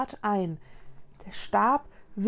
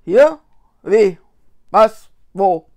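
loud, separate one-word bursts; flustered questioning; 14 dB louder, slower than usual. Acted. Ghost looks around his surroundings, flustered. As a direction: fire off bewildered questions one by one.

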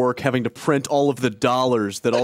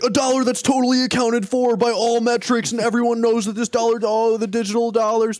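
excited audience member; 9 semitones higher than usual. Retold or imitated. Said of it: "He's like".

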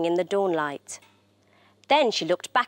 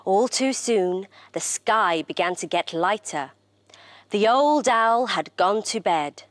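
ordinary delivery

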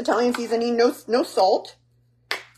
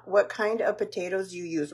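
ordinary talk

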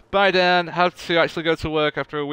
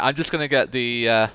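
normal talking voice

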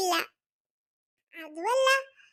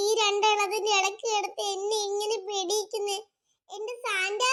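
ordinary talk, nothing marked

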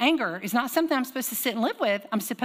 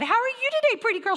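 much higher sing-song greeting; sweet lady voice; 14 semitones higher than usual. Retold or imitated. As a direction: coo it like a doting stranger. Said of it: "the lady behind us said".

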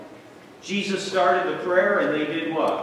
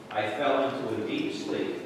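fading to a hush, softer and lower; drifting into sleep; 7 semitones lower, 8 dB quieter than usual. Acted. Preacher reading Daniel's collapse, fading to a whisper. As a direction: let the voice sink away like falling asleep.

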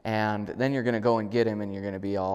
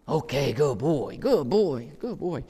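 put-on higher, slower, sing-song good boy; upbeat praise; 4 semitones higher, slower than usual. Shown as a demonstration. Trainer praises the dog arriving, bright and sing-song. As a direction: gush over him as he comes. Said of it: "reward him as soon as he gets".

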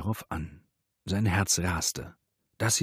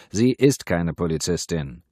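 somewhat louder, firm correction; mildly raised voice; calling out; 5 dB louder than usual. A narrator's reading. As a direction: call it across the room. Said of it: "rief Wouters".

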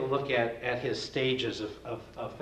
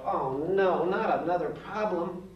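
louder, higher, a hammed-up child's dismay; mock groan; 5 semitones higher, 3 dB louder, slower than usual. Teacher imitates a child groaning at another math problem. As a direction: whine it like a kid sick of homework.

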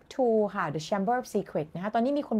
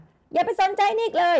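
high, loud comic yell; acted shout, pitched way up; mock shout; 11 semitones higher, 5 dB louder than usual. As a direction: holler it up from the floor. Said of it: "แล้วมันก็ตะโกนขึ้นมา".

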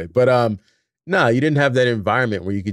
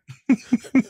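laughing; 5 dB louder than usual. Real, not acted. Laughter after story.

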